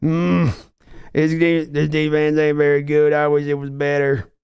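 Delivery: a streamer doing his Billy Bob Sling Blade impression on request. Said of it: "I think I got the Sling Blade".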